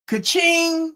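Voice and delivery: high-pitched, drawn-out "ka-ching"; gleeful mock till ring; cash-register ka-ching. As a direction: sing out the sound of money landing. Mimics cash register sound.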